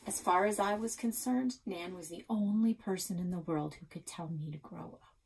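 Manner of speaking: ordinary delivery, nothing marked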